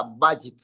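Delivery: "Bajit" stressed on Ba, pitched low; low "Bajit", weight on the first syllable; marked word stress. Shown as a demonstration. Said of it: "acá, acento acá".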